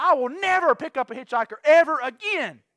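loud, high, hard-stressed oath; emphatic retold vow. Shaken driver swearing on the way home, loud and emphatic. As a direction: belt out the vow with punched words. Vows after frightening ride, retold.

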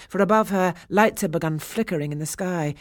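ordinary delivery

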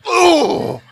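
high, loud hurt "ooh"; like getting hit in the chest; winded groan. Retold or imitated. Sixth grader punched in the sternum, loud hurt groan. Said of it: "he's like".

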